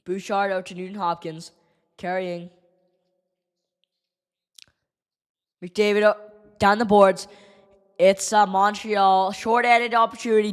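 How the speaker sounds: casual fan calling the game; lightly done play-by-play over the broadcast; live play-by-play. Acted. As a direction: call the play as it happens. Narrates game like commentator.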